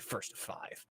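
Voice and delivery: breathy whispered "First to five.". Retold or imitated. Same challenge repeated, whispered.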